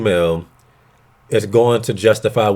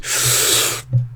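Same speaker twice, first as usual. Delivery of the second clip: dreamy hippie sigh; 8 dB quieter than usual. Retold or imitated. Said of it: "saying it like a hippie".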